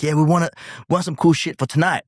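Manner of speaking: light American imitation; American visitor. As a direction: play the keen band member. Band member voiced.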